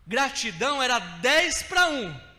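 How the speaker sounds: loud, high and slow, every word driven; preaching shout. Real, not acted. Pastor to brothers, loud slow preaching shout. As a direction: build to a preacher's cry. Preaching climax about gratitude.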